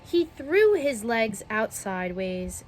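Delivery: plain reading voice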